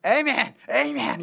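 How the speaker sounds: loud, high imitation of American amens; imitated noisy amen shouts. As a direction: mimic a congregation calling out amen. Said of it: "말씀 중에 시끄러워요".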